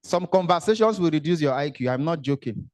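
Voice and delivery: ordinary talk, nothing marked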